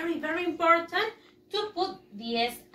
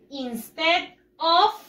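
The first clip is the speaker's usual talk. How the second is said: instead of slow and louder, rising on of; stress on instead of; 4 dB louder, slower than usual. Shown as a demonstration. Teacher pointing pupils to the phrase, slow and firm. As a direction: lean on instead of. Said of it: "look here".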